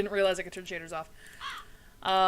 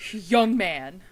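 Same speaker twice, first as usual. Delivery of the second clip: louder mock-stern telling-off; mock scolding; 7 dB louder than usual. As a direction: call out a naughty youngster, playfully stern. Repeats mock scolding of the character.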